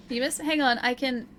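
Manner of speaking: usual speaking voice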